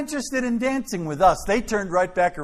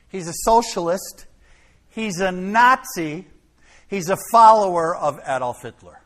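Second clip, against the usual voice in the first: ordinary delivery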